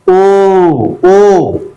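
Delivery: each O drawn out as "ooo"; long vowel. Shown as a demonstration. Teacher repeating how double O is pronounced, long O. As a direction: hold every O long, as in boot. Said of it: "long vowel sound".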